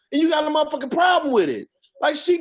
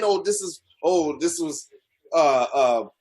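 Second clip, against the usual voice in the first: mocking critics; 8 semitones lower, slower than usual. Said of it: "people are saying".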